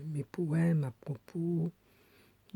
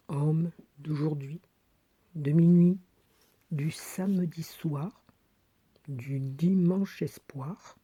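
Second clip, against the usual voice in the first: emphatic verse reading in short, separated phrases; poem recitation; 4 dB louder, slower than usual. Acted. Woman reads 1974 poem addressing modern man, emphatic recitation. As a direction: declaim it slowly, stretching the key words.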